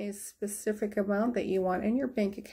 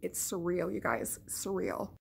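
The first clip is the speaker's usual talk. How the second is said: normal talking voice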